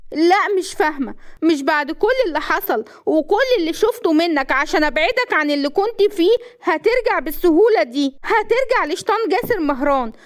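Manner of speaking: high-pitched, impassioned outburst; heated defiance. A narrator's reading. Wife forbidding his return to old ways, furious. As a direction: refuse him hotly, keep pushing.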